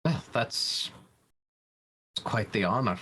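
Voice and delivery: choked up. Acted. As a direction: stumble over the words, mildly choked up. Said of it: "getting actually really choked up about this".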